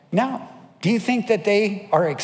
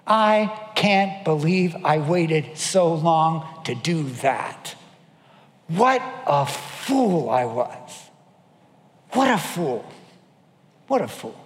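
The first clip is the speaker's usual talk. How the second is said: retold rueful realization; slower than usual. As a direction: marvel slowly at your own foolishness. Retold regret of the forgiven.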